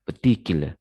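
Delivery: "petikil" with reduced first and last vowels; weak syllable example. Shown as a demonstration. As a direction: stress the middle of "petikil", weak schwas around it. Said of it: "itu termasuk pada weak syllables".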